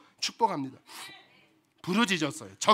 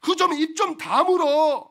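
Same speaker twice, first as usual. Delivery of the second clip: scolding crowd; 4 semitones higher, 7 dB louder, slower than usual. Acted. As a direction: scold the beggar to shut up. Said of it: "막 사람들이 야단을 쳐요".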